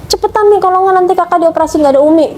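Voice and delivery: put-on high, urgent child's plea; retold tearful pleading. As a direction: imitate a distraught child begging mum to hurry. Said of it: "Dianya juga nangis".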